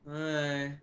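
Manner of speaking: slow, drowsy drugged mumble; slow doped-up groggy voice; stoned half-asleep. Acted. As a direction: drag the words out, barely awake. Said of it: "he's like".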